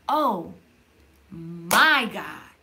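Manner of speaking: slow, hushed praise; reverent oh my God. Shown as a demonstration. Vlogger showing the worshipful way to viewers. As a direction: breathe "Oh... my God" in awe. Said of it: "Or it can be like".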